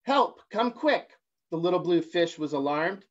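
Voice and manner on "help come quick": slow, high urgent call for help. A narrator's reading. Little fish calling friends, high and alarmed.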